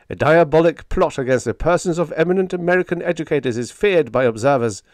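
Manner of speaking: loud, fast urgent news bulletin voice; radio announcer. A narrator's reading. Radio announcer interrupting dance music, urgent bulletin. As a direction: read it like a breathless radio flash.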